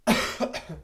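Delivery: short real cough from a cold. Real, not acted. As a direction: cough once, unvoiced. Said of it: "Entschuldigung, ich bin ein bisschen erkältet". (kurzes Erkältungshusten)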